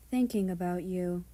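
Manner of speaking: ordinary delivery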